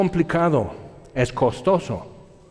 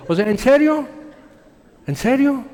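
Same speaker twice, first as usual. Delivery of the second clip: incredulous; 9 semitones higher than usual. Real, not acted. Preacher reacts in disbelief.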